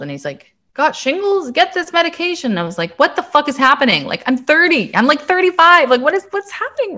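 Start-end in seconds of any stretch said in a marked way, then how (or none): 0.7-2.5 much higher, hammed-up pitchman voice; mock the old guy on the poster, pitched way up; old man in the ad. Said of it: "like, eighty-five years old". 3.0-7.0 much higher and louder, incredulous; disbelieving shock. Retold or imitated. Shocked at getting shingles.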